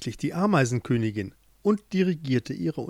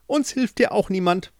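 curt refusal; 7 semitones higher, 3 dB louder than usual. Acted. Queen refuses.

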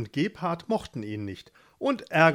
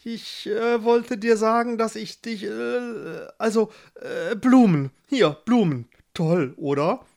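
high, halting, flustered suitor; nervous stammering; 7 semitones higher, 4 dB louder than usual. A narrator's reading. Shy Gustav offers Barbara flowers, stammering high and flustered. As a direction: stumble through it, then blurt out louder. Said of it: "stammelte der".

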